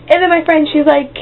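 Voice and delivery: ordinary delivery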